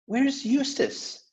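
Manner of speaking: put-on high, slow girl's question; Lucy's worried little question; Lucy asking. Lucy asks her brother where Eustace is, high and slow.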